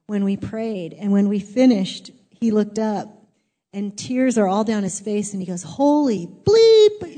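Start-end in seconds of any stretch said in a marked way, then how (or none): 5.6-7.2 imitated awe, higher and louder, drawn out slowly; amazed outburst. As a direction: stretch out a stunned exclamation, self-censored for comedy. Retold convert's amazed outburst after prayer.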